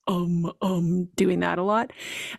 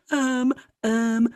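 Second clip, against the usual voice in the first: mock floundering, higher and hurried; flustered self-impression; 6 semitones higher, 4 dB louder than usual. Host mocking herself beside her organized co-host, flustered self-impression. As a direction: stumble over the ums, then rush up into a helpless question. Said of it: "That's my impression".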